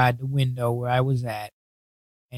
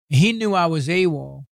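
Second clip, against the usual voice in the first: ordinary talk, nothing marked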